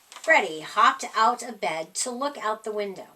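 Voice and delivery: ordinary delivery, nothing marked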